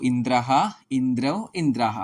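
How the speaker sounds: ordinary talk, nothing marked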